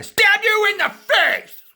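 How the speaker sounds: explosive, high, drawn-out yell; hammed-up screaming threat; sudden mock shout. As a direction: burst into a crazed bellow. Acts out sudden stabbing threat.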